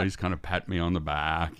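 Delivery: normal talking voice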